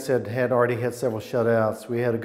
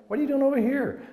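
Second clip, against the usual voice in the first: high mocking heckle, pitched way up; harassing taunt; 14 semitones higher, faster than usual. Opposing players heckle the kicker, in a high mocking taunt. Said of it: "started harassing him".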